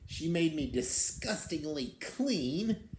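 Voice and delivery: put-on lively read-aloud for children; storybook reading. A narrator's reading. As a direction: tell it like a picture book to kids. Reads the beast's tale aloud.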